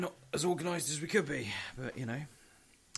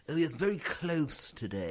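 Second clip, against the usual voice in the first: mock local; 4 semitones higher, 3 dB louder than usual. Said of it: "people use the phrase".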